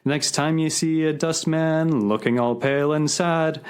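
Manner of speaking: sing-song recited verse, music-hall lilt; cheery recitation of a Cockney ditty; comic song recital. Acted.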